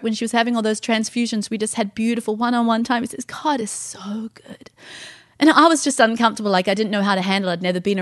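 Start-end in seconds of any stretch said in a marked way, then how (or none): 3.3-4.7 slow, very soft whisper; reverent whisper. Retold or imitated. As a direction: stretch it out, hushed and awed. Husband's repeated praise.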